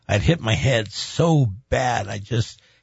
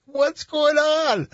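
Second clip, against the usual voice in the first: exasperated; much slower than usual. Retold or imitated. Exasperated quote, high slow.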